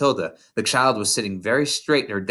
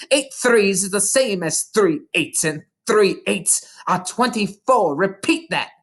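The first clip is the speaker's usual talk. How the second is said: shout; 7 semitones higher than usual. A narrator's reading. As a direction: bark it at the dangling boy. Swinging Wilfred by the ankle.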